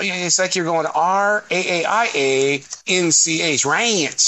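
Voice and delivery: "ranch" stretched long like a southern drawl; drawn-out ranch. Retold or imitated. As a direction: spell it out, then drag "ranch". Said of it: "that's an extra letter you're putting in there".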